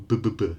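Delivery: B, B, B as short voiced bursts; three quick voiced B sounds; isolated B sound. Shown as a demonstration. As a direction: sound out the letter as a repeated buh. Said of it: "final sound".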